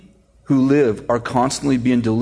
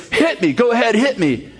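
higher-pitched taunting dare; goading boxer; 9 semitones higher than usual. Retold or imitated. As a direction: egg the opponent on. Rocky dares opponent to hit.

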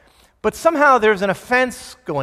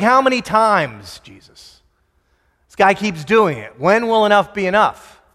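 exasperated Peter; 2 semitones higher, 7 dB louder than usual. Acted. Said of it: "Jesus, like".

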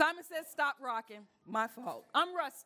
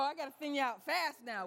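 usual speaking voice

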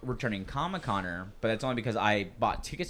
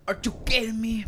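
mock outraged echo; 12 semitones higher, 4 dB louder than usual. Echoing friend's outraged line.

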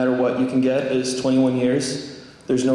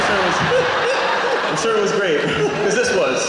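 higher voice, talking through laughter; amused, words riding on a laugh; 7 semitones higher than usual. Real, not acted. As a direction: laugh while getting it out. Laughing at the Sweden mix-up.

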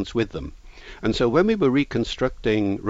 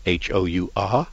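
usual speaking voice

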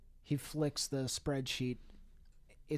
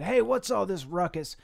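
higher, louder mock-boss demand; boss calling out; 6 semitones higher, 8 dB louder than usual. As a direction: demand answers like an interrupting boss. Boss confronts the party wreckers.

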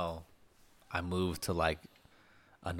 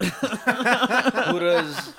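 laughing; 13 semitones higher, 10 dB louder than usual. Real, not acted. Laughing repeat of question.

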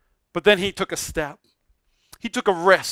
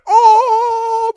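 high, long "oh" of delight; delighted cry; 14 semitones higher, 5 dB louder than usual. Retold or imitated. Wife as the baby collapses into her, high excited "oh". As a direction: imitate a thrilled mother's squeal. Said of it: "she went".

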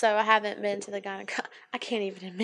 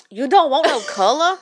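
louder, pointed mock question; sassy; 3 semitones higher, 6 dB louder than usual. Nail tech questioning her clear polish with sass. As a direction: throw some sass into the question. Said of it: "Oh, so she had sass behind it".

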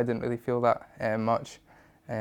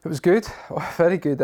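laughing; 6 semitones higher, 6 dB louder than usual. Real, not acted. Laughing at question on upbringing.

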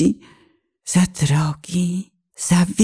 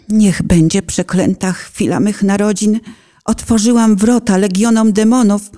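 ordinary delivery

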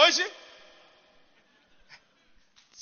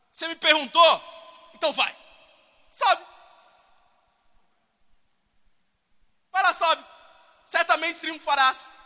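higher, mocking sarcastic dare; 3 semitones higher, 3 dB louder than usual. Preacher voices Micaiah mocking the king, sarcastic. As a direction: toss it off with a smirk. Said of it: "muito sarcástico".

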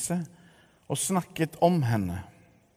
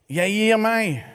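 high, louder half-laughing sigh of surrender; nesten lattermildt sukk; 8 semitones higher, 6 dB louder than usual. A narrator's reading. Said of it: "sukket hun nesten lattermildt". (nesten lattermildt, høyt oppgitt)

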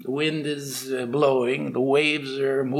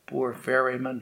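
usual speaking voice

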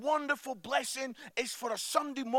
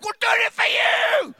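much higher and louder, hammed-up frantic dad; retold shout; 12 semitones higher, 13 dB louder than usual. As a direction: yell it in the chaos. Dad yells at daughter.

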